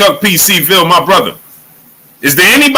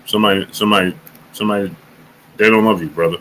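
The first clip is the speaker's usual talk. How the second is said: low, much softer, quick; mock whisper; 8 semitones lower, 7 dB quieter, faster than usual. Streamer pretending to whisper to Chisora, low and quick. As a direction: murmur it fast into his ear. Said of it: "I be wanting to whisper in Chisora's ear".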